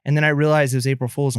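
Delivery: ordinary talk, nothing marked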